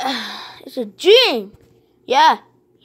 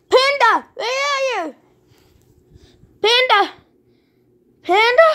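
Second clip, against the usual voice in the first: calling out; 8 semitones higher than usual. Pretend. Calling for a lost friend.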